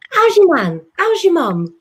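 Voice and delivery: hammed-up advert impression. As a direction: call it out like the advert greeting. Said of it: "do that impression then from the advert".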